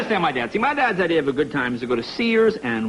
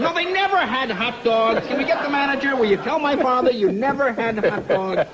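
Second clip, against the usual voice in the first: exasperated demand; 6 semitones higher than usual. Retold or imitated. Demanding the manager at McDonald's.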